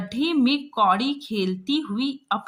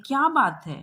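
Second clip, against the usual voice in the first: asking what's wrong; 5 semitones higher, 4 dB louder than usual. Acted. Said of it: "और पूछते हैं".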